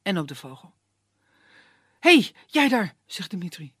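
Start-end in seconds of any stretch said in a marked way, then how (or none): 2.0-3.0 high, loud call across the path. Acted. Impatient young man hailing the woodsman, loud call. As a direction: hail the stranger urgently. Dimitri calls to old man.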